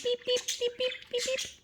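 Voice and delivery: very high, soft bird beeps; high beep imitation. Shown as a demonstration. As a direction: chirp the beeps like a tiny bird. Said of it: "can you say beep".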